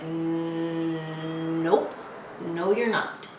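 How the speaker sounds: low, drawn-out put-down from inside the head; inner monster voice. Acted. Inner voice doubting someone who feels good, low monster put-down. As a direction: sneer it low, stretching the first words. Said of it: "some sort of crazy monster voice".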